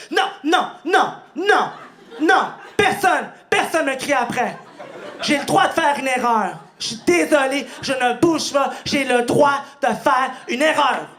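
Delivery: high, loud defensive yelling; pleading shout. Retold or imitated. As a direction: shout your right to a mistake. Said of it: "c'est avec cette voix-là".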